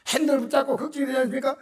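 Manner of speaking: ordinary talk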